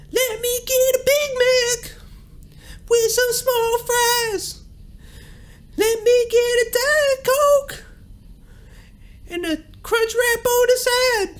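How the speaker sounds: very high rock-singer wail, drawn out. Pretend. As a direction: order fast food in a mock rock screech. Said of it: "imagine him at fucking McDonald's".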